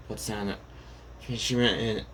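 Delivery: ordinary delivery, nothing marked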